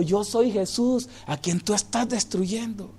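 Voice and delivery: soft, hushed, solemn; quiet solemn statement; hushed first take. Acted. Same line repeated louder.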